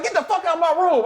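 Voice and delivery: very high mock outburst; imitating angry coach. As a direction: order him out in a high, comic coach voice. Retelling coach throwing him out.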